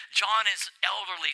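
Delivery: normal talking voice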